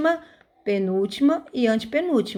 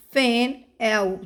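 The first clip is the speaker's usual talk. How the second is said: usual speaking voice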